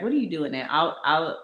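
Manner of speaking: ordinary talk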